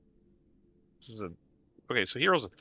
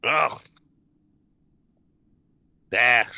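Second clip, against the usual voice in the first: loud grumpy monster grunt; dismissive creature grunt; 8 dB louder than usual. Acted. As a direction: brush them off with a grunt.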